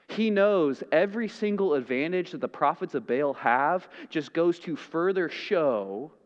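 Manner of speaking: usual speaking voice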